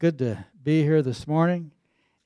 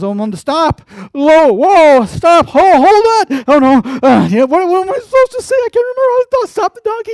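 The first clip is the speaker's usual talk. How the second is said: hammed-up panic, climbing very high and loud; frantic shouts spilling out ever faster; panicked yelling; 13 semitones higher, 10 dB louder than usual. Rider can't stop donkey before the drop-off, hammed-up panic.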